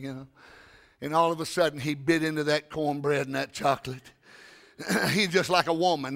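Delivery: ordinary talk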